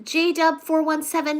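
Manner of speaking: ordinary talk, nothing marked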